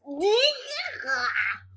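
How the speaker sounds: high drawn-out noise effect; drawn-out high squawk; imitated noise. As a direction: make a high, stretched silly noise. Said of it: "and it goes".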